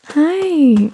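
high sing-song hi. Real, not acted. Greeting baby.

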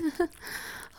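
unvoiced, high airy giggle. Lover giggles breathlessly to partner, airy. Dominant partner laughing mid-scene.

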